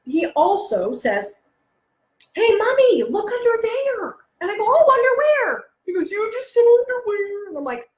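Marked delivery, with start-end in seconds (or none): 2.3-4.1 put-on high little-kid call to mom; imitated eager child. Son setting up joke for Mommy, high eager voice. 4.6-5.6 high, loud playful answer; mock innocent reply. Mother falling for son's joke, high mock innocence. 6.1-7.5 put-on high, teasing kid voice; imitated gleeful child. Son teasing Mommy after his trick, high gleeful voice. Son gloats over the joke.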